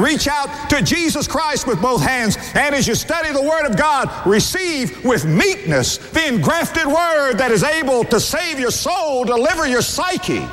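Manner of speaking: high, ringing sermon appeal; sustained high-pitched pulpit exhortation; fervent preaching. Real, not acted. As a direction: preach it high and stretched, pleading with the congregation. Preaching at full force.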